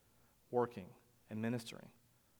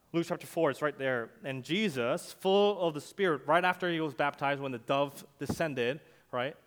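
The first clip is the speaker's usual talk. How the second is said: loud, higher, ringing sermon voice; full-force preaching; 3 semitones higher, 7 dB louder than usual. Acted. Pastor preaching to his congregation, loud and rising. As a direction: preach it out to the back row.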